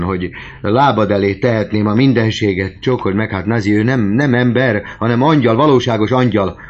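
loud, high-pitched rapturous raving; fervent outpouring. A narrator's reading. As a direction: pour out adoration, swelling to the last word. Goriot raves with fervent gratitude.

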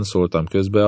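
plain reading voice

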